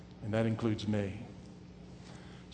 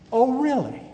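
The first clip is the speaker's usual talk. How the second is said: high mock surprise, dripping with irony; God mocking a preachy prayer, high sarcastic voice; 11 semitones higher, 6 dB louder than usual. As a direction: play God teasing the know-it-all. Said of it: "I can see God up in heaven saying".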